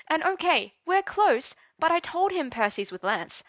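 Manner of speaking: high, rushed panic; frantic worried teen; panicked. Acted. Arthur panicking about his father.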